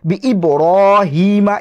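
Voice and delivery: বি ই বড় হিমা with a thick, held "ro"; heavy R recitation. Shown as a demonstration. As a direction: round the r in বড় to a full "ro", then stretch হিমা. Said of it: "এই যে মোটা করে পড়তে হবে".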